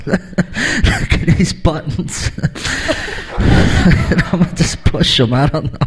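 slow, laughing and half-whispered; giggling stoned pilot. Acted. Stoned pilot laughing at the cockpit buttons. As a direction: giggle through every word, slowly.

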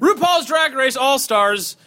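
high, slow grand announcer-style voice; rich voice. Shown as a demonstration. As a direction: announce it with carefree glee. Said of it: "I've been talking more and more like that".

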